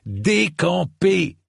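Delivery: high, long-drawn roar; full-voiced, drawn-out bellowed order; roaring command. A narrator's reading. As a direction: roar it out, stretching both ends of the word. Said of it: "mais en rugissant pour le coup".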